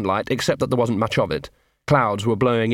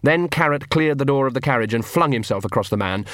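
ordinary delivery

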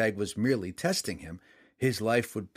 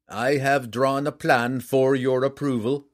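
formal warrior king; 3 semitones higher, 6 dB louder than usual. A narrator's reading. King offers his plan.

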